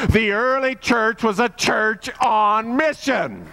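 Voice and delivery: rally the church to mission with a ringing cry; full-force preaching. Acted.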